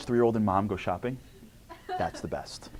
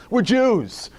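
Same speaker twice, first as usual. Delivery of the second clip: ordinary talk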